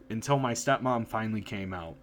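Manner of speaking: usual speaking voice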